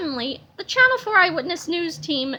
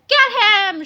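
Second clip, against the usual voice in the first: higher, loud rallying shout; 6 semitones higher, 7 dB louder than usual. A narrator's reading. Old ladies sending the mob after Melvin, shouting. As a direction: urge the mob on. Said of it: "shouted the old ladies".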